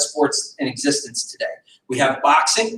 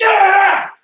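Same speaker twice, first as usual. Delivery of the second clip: high, loud burst of a lunging yell; lunging in frustration; mock angry grab yell; 13 semitones higher, 6 dB louder than usual. Instructor as attacker lunging to grab, high loud yell. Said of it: "and I just".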